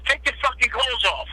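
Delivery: a hint of a squeaky mobster; high-pitched Tommy. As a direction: imitate the boss's high voice, lightly. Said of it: "he talks like Mickey Mouse".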